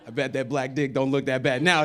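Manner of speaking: laughing. Retold or imitated. Gloating joke with laugh.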